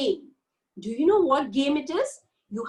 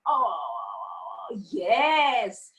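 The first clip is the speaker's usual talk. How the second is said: yawn; 6 semitones lower than usual. Shown as a demonstration. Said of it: "Come on".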